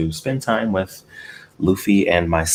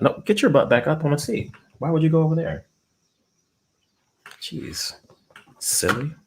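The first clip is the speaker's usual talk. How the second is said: high-pitched pet scolding, much higher; scolding the cat; 10 semitones higher than usual. Real, not acted. Owner scolds cat for leaving the seat, high-pitched scolding. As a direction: scold him like a naughty kitty, pitched way up.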